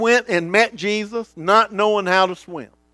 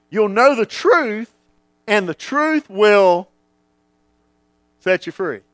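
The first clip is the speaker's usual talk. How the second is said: scripture reading; 3 semitones higher, 4 dB louder than usual. Acted. Quoting John 8:32 in a sermon.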